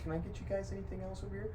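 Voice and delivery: high-pitched, quick timid server. Acted. A timid server checking on the table, high and hurried. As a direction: squeak out a hurried, timid offer. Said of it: "timid, timid servers".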